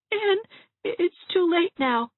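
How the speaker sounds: very high, stammering whimper; tearful protest. A narrator's reading. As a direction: protest feebly through tears. Dovey in tears, protesting feebly.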